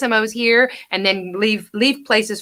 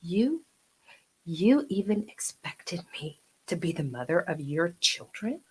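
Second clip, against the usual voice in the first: soft, low, disbelieving; acting coach showing the monologue line, soft; quiet seething; 3 semitones lower, 10 dB quieter than usual. Shown as a demonstration. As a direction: seethe it under your breath. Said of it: "And you wanna go".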